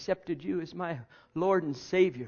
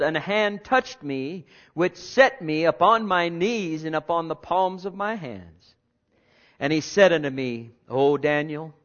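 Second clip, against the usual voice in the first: scripture reading; 5 dB louder than usual. Acted. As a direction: read the passage with weight and awe.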